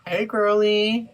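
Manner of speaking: fake-sweet, slow hello to an enemy; sarcastic hey girly. Streamer sarcastically greeting a rude chatter, sugary.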